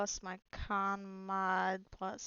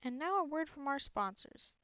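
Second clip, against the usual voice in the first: ad announcer; 5 semitones higher than usual. Pretend. Mock ad announcer.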